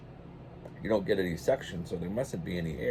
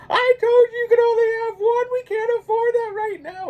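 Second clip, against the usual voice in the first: very high, loud, scolding wife voice; mock wife's fit; 9 dB louder than usual. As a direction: shriek like the exasperated wife. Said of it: "my wife's having a fit".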